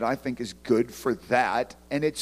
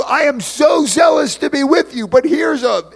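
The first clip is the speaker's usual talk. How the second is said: higher and louder, gushing then lecturing; overzealous letter writer; 9 semitones higher, 8 dB louder than usual. Retold or imitated. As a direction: ham up an overeager preacher. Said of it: "it would be like you".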